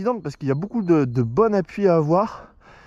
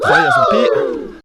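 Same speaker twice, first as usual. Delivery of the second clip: excited squeal; 7 dB louder than usual. Real, not acted. Thrilled after clean run.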